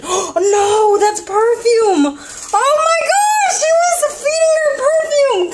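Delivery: sudden panic at the perfume, yelled high; alarmed shout. Real, not acted. Sees baby fed perfume, alarmed.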